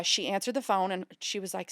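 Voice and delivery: ordinary talk, nothing marked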